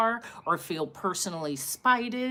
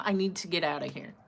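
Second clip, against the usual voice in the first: usual speaking voice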